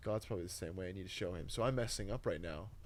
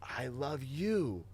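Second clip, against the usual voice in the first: slow, raised, higher cry to Jesus; half-recreate the desperate daily cry; drawn-out mock scream of devotion; retold screaming prayer; 7 semitones higher, slower than usual. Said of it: "I was screaming it every day".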